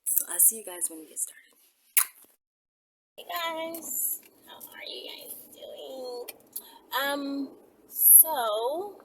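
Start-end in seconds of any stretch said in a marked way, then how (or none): 0.0-1.4 whisper. Real, not acted. Signing off before the start. 3.2-6.3 whisper. Real, not acted. Greeting her viewers.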